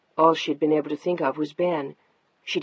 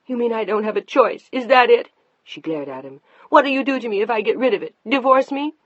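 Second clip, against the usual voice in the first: higher, louder furious questions; angry confrontation; 6 semitones higher, 6 dB louder than usual. Acted. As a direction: challenge him, fast. Wife confronts husband angrily.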